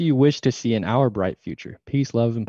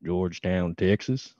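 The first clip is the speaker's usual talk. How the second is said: put-on Texas accent, pitched a little low; deliberate Texas twang; Southern accent; 4 semitones lower, 4 dB quieter, slower than usual. Shown as a demonstration. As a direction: say the town in a self-conscious Southern accent. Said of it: "Let me hear your Southern accent".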